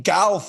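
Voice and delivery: golf drawn out as "galf"; mock his "galf" vowel in golf; imitated mispronunciation. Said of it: "What accent is golf?".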